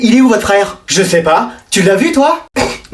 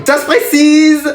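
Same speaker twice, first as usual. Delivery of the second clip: slow teasing hint; 5 semitones higher, much slower than usual. Acted. Teacher drawn-out encouragement.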